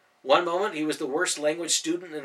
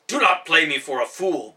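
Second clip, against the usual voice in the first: angry warning; 3 semitones higher, 9 dB louder than usual. A narrator's reading. Ben attacking Nicholas.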